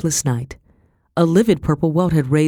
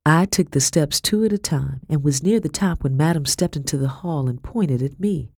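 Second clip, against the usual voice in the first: ordinary delivery, nothing marked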